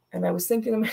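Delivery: ordinary talk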